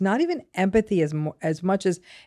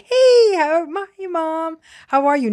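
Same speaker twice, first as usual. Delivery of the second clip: high, bright put-on child greeting, much louder; forced cheerful; 10 semitones higher, 5 dB louder, slower than usual. Child coming home greets mom, forced cheerful and much higher. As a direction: sing out a fake happy hello. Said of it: "you make sure you come in cheerful".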